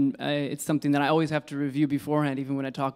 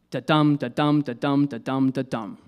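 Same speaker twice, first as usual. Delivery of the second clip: five even beats of da-DUM, stress on each DUM; iambic pentameter. Shown as a demonstration. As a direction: chant da-DUM five times in a steady pulse, easing off at the end. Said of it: "iambic pentameter is five iambs".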